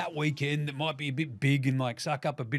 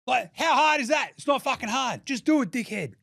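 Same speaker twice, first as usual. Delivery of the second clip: high, louder exasperated rant; 9 semitones higher, 4 dB louder than usual. Real, not acted. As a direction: vent at the league. Ranting at the league.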